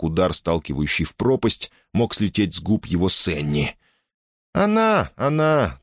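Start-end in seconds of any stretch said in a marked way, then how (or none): 4.5-5.8 high-pitched, eager assurance; hasty reassurance. A narrator's reading. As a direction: reassure him in a hurry. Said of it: "торопливо заверила его девушка".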